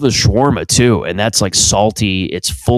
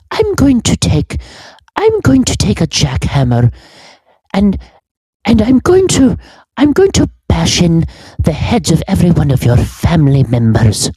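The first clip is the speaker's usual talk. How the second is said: put-on prim old schoolmistress scolding a pupil, pitched way up; McGonagall voice; 8 semitones higher than usual. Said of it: "sounds a little more wholesome".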